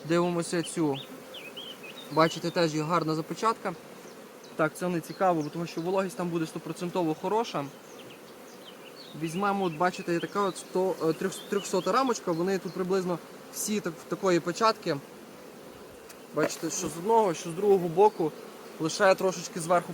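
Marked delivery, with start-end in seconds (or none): none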